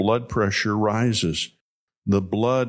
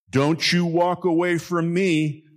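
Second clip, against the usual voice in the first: raised, higher furious shout after a walking-away son; angry yell at son; 8 semitones higher than usual. Retold or imitated. Father shouting after his son down the hall, angry. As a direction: call him back down the hall in anger.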